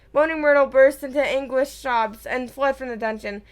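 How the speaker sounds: ordinary delivery, nothing marked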